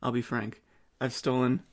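ordinary talk, nothing marked

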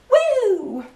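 high, sliding "woo"; excited woo. Real, not acted. Showing off the bright band, excited woo. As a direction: let out a high, drawn-out whoop. Exclaims over the look.

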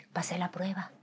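hushed, breath-only self-congratulation; whispered triumph. Retold or imitated. Telling herself she resisted temptation, whispered. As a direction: whisper it privately to yourself. Retold private relief.

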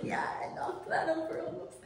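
high, airy laugh through the words; laughing self-mimicry. Laughing at talking to a ghost like FaceTime, giggly mimicry. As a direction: quote yourself while giggling, voice rising. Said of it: "like I'm on FaceTime".

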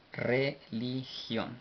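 very slow "Religión", pauses between syllables. Shown as a demonstration. Teacher repeats translation word syllable by syllable. ("Religión" muy despacio, sílaba por sílaba)

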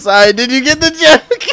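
real laughter through the words; laughing. Real, not acted. Laughing while repeating the line.

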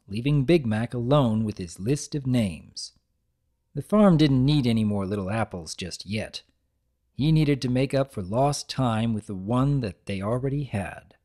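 ordinary delivery, nothing marked

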